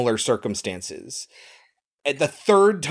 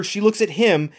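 usual speaking voice

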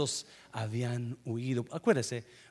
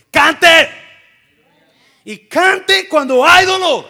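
very loud and much higher; shouted command; 13 semitones higher, 22 dB louder, slower than usual. Real, not acted. Preacher shouting at the congregation to sing. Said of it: "exhorting congregation".

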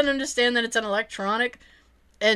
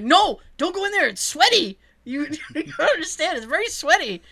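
raised, urgent imitation of a kid hiding something; panicky insistence; 5 semitones higher than usual. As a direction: frantically keep your brother out. Kid urgently blocks brother from shed.

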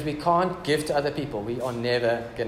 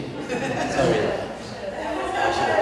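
high, giggling, breathy sorry; laughing apology; 10 semitones higher, much slower than usual. Real, not acted. Pastor apologizing to Kirst for nearly swearing, giggling high.

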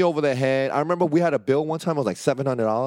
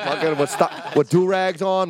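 usual speaking voice

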